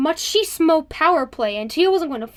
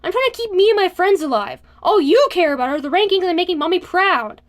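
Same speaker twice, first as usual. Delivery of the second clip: raised, sharp and loud; spitting angry; 5 semitones higher, 5 dB louder than usual. A narrator's reading. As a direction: spit the words out in anger. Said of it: "he spat".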